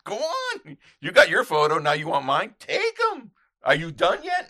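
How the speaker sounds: re-enacted scolding yell; exasperated telling-off. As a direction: goad them in an annoyed shout. Angrily telling off yelling photographer.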